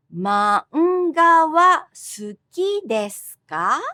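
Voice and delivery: "Manga wa suki desu ka?" slow, rising on ka; slow question rise. Shown as a demonstration. Teacher modelling a question, slow rising tune. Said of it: "Just the rising intonation and the sound ka".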